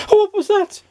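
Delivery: loud, very high squeal; terrified shriek. A narrator's reading. Mister Jelly hearing the tramp snore, terrified shriek. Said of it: "shrieked Mister Jelly".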